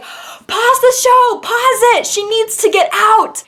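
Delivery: high, strained mock yelling; imitated crowd shouting. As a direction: call out to a stage like an alarmed crowd. Retells crowd yelling to the band.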